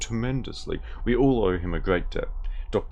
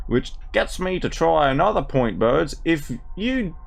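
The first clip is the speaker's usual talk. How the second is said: broad ocker Australian voice, played for laughs; reading a butterfly book as a brash Australian; mock Australian accent; 9 semitones higher, slower than usual. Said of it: "we're going with the Darrow Australian".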